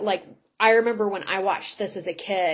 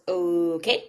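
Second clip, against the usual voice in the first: drawn-out, doubtful okay; hesitantly going along with it; hesitant agreement; 3 semitones lower than usual. Retold or imitated. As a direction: agree slowly and uncertainly. Matt reluctantly agrees to master.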